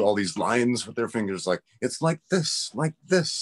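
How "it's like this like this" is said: high-pitched imitation of teachers demonstrating; mock tone teacher. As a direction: mimic an eager teacher tracing tones. Mimicking tone teachers with finger lines.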